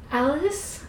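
high, weak, pained call; groan. A narrator's reading. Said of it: "I groaned".

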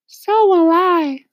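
very high loud slow lady voice; Miss Spider voice. A narrator's reading.

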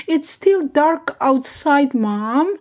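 mild drawn-out protest; protesting child. A narrator's reading. Sleepy daughter protests to mother.

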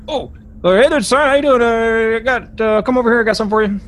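high, loud eager ship steward; a pushy cook calling the young man over; Buzz the steward. Acted. Steward greets Tassan, loud and high.

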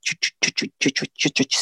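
whispered rhythmic chugging; imitated heart monitor. Mother recalls the baby heartbeat monitor, imitated rhythmic chugging. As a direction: mimic a monitor's heartbeat with unvoiced ch sounds. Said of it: "fell asleep on that sound".

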